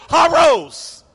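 loud, high call across the restaurant. Retold or imitated. Server calls out to diners, loud and high-pitched. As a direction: belt it out like a server announcing fresh rolls. Waiter calls out hot rolls.

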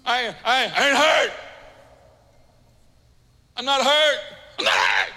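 mock denial.

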